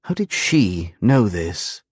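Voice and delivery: ordinary talk, nothing marked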